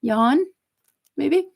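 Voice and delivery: ordinary talk, nothing marked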